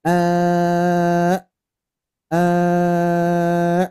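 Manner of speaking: "eh" cut off sharply by the throat; short stopped hamzah. Shown as a demonstration. Teacher showing the right hamzah that stops straight away. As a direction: stop "eh" at once, no drag. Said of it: "nah, gitu aja. Langsung berhenti".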